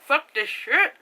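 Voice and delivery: fed-up curse. Retold or imitated. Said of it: "she's like".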